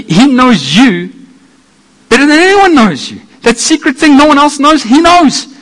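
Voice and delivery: high, loud, ringing proclamation; full-force preaching. Acted. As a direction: preach it out to the whole room.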